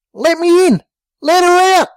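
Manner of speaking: high, banging shout; pounding on Jimbo's door, yelling high; yelling through the door. A narrator's reading. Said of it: "Both Dingo and Bricko yelled".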